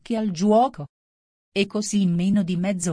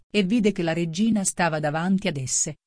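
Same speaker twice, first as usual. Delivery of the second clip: usual speaking voice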